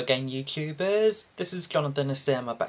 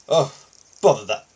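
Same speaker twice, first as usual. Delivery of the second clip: mildly cross, louder grumble at the phone; a touch of annoyed boss; frowning grumble; 4 semitones lower, 5 dB louder than usual. Acted.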